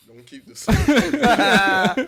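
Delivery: high and loud, laughing through it; slow laughing retelling of bad advice; laughing mock quote. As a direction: crack up while quoting the friend. Quoting friend, laughing.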